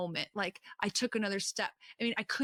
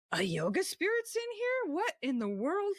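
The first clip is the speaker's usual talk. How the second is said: much higher, disbelieving cry; retelling her own shocked cry, pitched way up; shocked disbelief; 10 semitones higher than usual. Retold or imitated. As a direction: gasp it out in sheer disbelief. Shocked at the yoga spirit.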